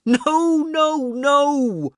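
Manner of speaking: loud, very high anguished howl. A narrator's reading. Bram watches the lad stagger and howls in anguish. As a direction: howl it in despair.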